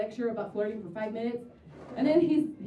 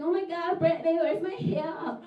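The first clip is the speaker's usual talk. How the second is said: put-on drunk girl voice; 6 semitones higher, 5 dB louder than usual. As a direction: wail like a tipsy clubgoer. Drunk girl lost heel.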